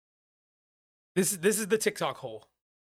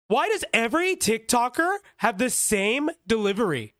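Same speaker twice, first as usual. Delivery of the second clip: TikToker delivery; 6 semitones higher than usual. Retold or imitated. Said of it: "he likes to talk like this".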